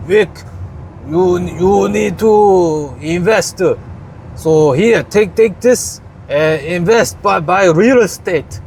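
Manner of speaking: put-on light, halting Korean accent; a hint of a Korean accent, slow. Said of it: "my horrible attempt at Korean accent".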